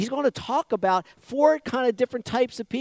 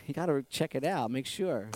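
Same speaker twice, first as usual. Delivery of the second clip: usual speaking voice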